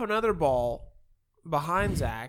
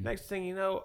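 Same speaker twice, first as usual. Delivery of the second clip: ordinary delivery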